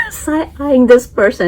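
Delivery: giggling as she points it out; laughing. Real, not acted.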